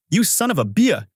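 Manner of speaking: mild storyteller's yell, pitched high; angry outburst into the phone, held back. A narrator's reading. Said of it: "I yelled into the phone".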